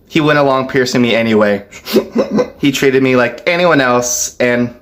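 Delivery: ordinary talk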